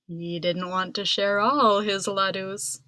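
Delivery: ordinary delivery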